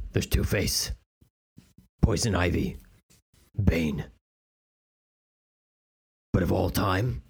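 low, grave superhero with a speech impediment; Batman. Acted. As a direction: brood in a low register, pausing, mispronouncing your Rs. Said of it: "This is the no time for speech therapy Batman".

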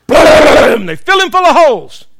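loud, high blast; booming dramatic climax; explosive storytelling shout. Acted. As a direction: shout the gunfire and its result. Dramatic climax of firing squad story.

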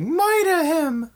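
shrill, high, slow screamed command; high shrieking order. Acted. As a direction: screech the order like a spoiled child. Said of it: "And then he's like".

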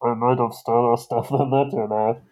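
muffled through a cage. Acted. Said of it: "muffled speaking that's not quite audible".

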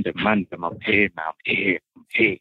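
ordinary talk

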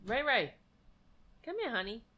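soft, sweet call to a pet; calling the cat. Real, not acted. Calling her cat Ray over, soft coaxing voice. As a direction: coax the cat over gently.

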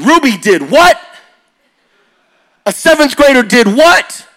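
high, loud incredulous what; disbelief. Retold or imitated. High schoolers hearing about Ruby, high loud disbelief. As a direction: react like you can't believe it.

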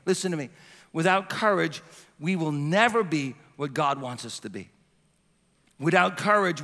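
ordinary talk

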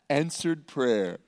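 low, slow, hushed mock reverence; mock piety. Preacher joking about unfairness in his favor, mock-pious. Pious punchline after the outrage.

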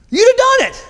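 a comic falsetto jab; mock squeaky emphasis. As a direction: squeak it up to make the point. Servant reasoning with Naaman.

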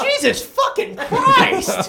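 high, loud, drawn-out exclamation; hammed-up shock. Viewer reacting to the bridge throw, aghast. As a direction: exclaim slowly in laughing disbelief.

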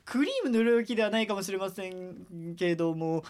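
ordinary delivery

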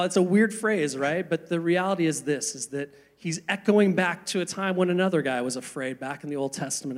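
usual speaking voice

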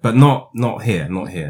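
usual speaking voice

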